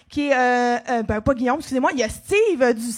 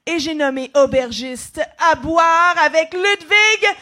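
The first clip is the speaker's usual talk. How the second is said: announcer voice; 3 semitones higher, 8 dB louder, much slower than usual. Acted. Announcing the first segment.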